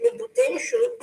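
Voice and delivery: said high through real laughter; laughing. Real, not acted. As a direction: laugh out the words in disbelief. Laughing at ex's demand.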